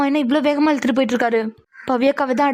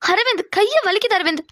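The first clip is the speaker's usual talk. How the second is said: higher, startled cry of pain; pained plea; 6 semitones higher than usual. Acted. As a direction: plead while your arm is being twisted. Husband still gripping her arm.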